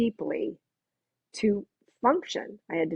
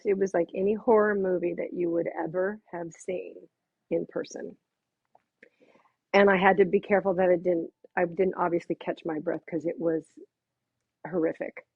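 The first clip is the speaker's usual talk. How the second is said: usual speaking voice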